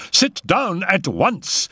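high, slow, emphatic order; alarmed command. A narrator's reading. Raoul orders the mother back down, alarmed. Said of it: "He was really alarmed now".

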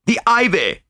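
high-pitched exclamation; sudden cry. A narrator's reading. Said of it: "I cried".

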